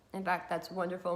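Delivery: ordinary delivery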